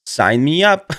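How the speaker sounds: ordinary talk, nothing marked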